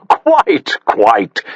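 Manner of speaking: hearty posh gentleman voice; posh chuckling "quite". A narrator's reading. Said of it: "He laughed heartily at everything and said".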